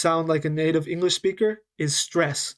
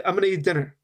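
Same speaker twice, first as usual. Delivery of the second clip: quick, the words run together as "I'm gonna eat dinner"; native linked rhythm; much faster than usual. Shown as a demonstration. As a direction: blend "I'm gonna" into one smooth natural flow. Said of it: "spoke it more in a native rhythm".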